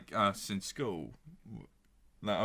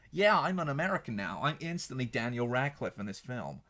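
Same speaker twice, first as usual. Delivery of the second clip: put-on generic American accent; 3 semitones higher than usual. Mocking an actor's instant transformation, generic American accent. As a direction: put on a bad all-purpose American voice. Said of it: "Do you want an American accent?".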